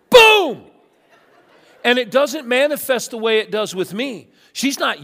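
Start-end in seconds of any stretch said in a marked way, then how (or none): 0.1-0.6 much higher, loud burst; sudden explosion. Retold or imitated. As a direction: blow up all at once. Wife's anger finally erupts.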